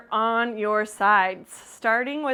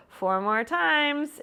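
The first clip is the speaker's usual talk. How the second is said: ordinary delivery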